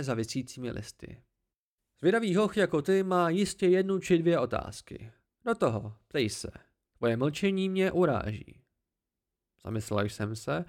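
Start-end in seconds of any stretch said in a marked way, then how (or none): 2.0-8.6 higher, smug voice of the hidden Cthaeh; haughty tree creature. Acted. The Cthaeh corrects the boy, higher and smug. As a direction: talk down to the boy from the branches, pleased with yourself.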